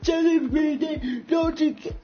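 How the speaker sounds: slow high whimpering plea; mock pleading Willie. Willie phoning Reedy from his hotel, slow high whine. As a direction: drag it out like a begging wimp.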